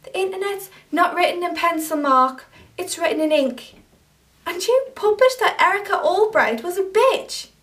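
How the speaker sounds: angry confrontation. Acted. To Mark, for calling her a bitch online: angry and accusing. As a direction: tear into him for what he posted.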